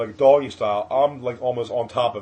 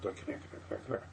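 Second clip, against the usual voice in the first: whispered come here; 11 dB quieter, much faster than usual. Retold or imitated. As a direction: whisper it urgently from offstage. Guys whisper-wave him over.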